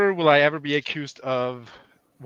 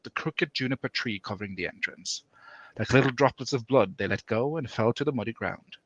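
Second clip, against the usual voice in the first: story reading; 4 semitones lower than usual. Acted. Reads story aloud.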